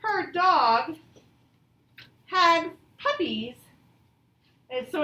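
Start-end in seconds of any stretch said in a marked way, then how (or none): 0.0-3.5 louder and higher, excited read. Shown as a demonstration. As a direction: share the news with delight. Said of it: "it needs to have a capital H".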